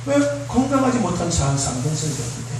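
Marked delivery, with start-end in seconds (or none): none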